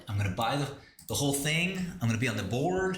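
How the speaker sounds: ordinary talk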